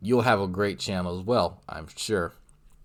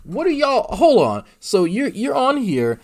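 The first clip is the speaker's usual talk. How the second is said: high, loud, disbelieving outburst; incredulous interruption; 11 semitones higher, 6 dB louder than usual. Real, not acted. Streamer interrupting G-Man's introduction, loud incredulity. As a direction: cut in loudly, pitch jumping up.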